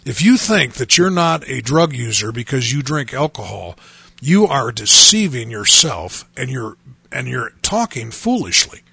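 usual speaking voice

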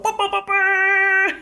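sung horn blast, high and slow; mouth reggae horn. Pretend. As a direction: mimic a reggae air horn. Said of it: "It's a good reggae horn".

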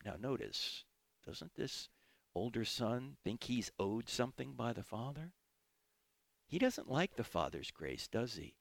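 ordinary talk, nothing marked

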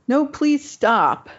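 high, slow, teary plea; tearful pleading. Retold or imitated. Crying patient begs the nurse to stop swabbing, high and slow. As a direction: beg her to stop, near tears, drawn out and high. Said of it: "And I start crying".

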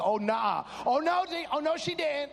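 high, sassy outrage building in pitch; hammed-up "oh no you didn't" attitude; sassy indignation. Preacher replays his reaction to the rock, sassy and indignant.

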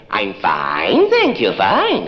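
same words with a weary edge; exasperated fine. Acted. Caller stuck answering a toddler, exasperated.

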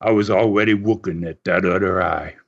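put-on low, measured Dutch-accented voice. Dutch wrestler taunting his hurt opponent, Dutch accent. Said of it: "he says in this Dutch accent".

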